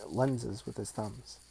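plain reading voice

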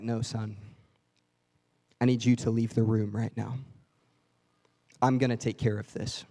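stern father sending son out; firm father. Retold or imitated. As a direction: calmly take charge as the father. Dad voiced, firm and low.

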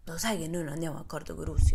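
a hint of a wary soldier; suspicious friend. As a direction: murmur doubts to his friend. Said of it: "fa Nate".

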